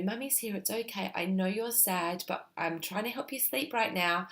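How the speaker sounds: put-on mother quietly comforting her crying toddler; calm and soft. Shown as a demonstration. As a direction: soothe a sad child toward sleep. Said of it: "talk to them really calmly and really softly".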